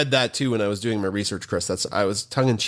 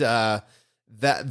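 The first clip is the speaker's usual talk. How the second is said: normal talking voice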